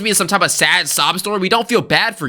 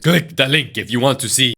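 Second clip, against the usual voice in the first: lower-pitched, badly faked Russian accent; attempted Russian accent; 5 semitones lower than usual. Shown as a demonstration. Host mimics the uploader's link pitch, attempted Russian accent. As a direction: try a clumsy Russian accent. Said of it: "What's a Russian accent?".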